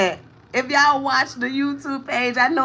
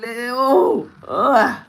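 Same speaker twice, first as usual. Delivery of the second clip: pained groan. Real, not acted. Overcome while talking.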